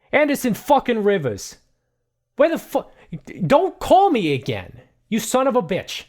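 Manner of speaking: high, loud, cursing tirade; furious phone rant. Acted. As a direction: scream abuse down the phone at him. Angry man swearing on phone.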